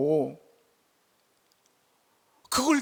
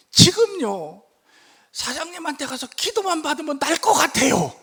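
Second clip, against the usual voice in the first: much higher, louder pleading; pleading boss; 12 semitones higher, 8 dB louder than usual. Retold or imitated. Gang boss begging the pastor for healing prayer, loud. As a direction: beg to come for prayer. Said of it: "그 뒤에 말이".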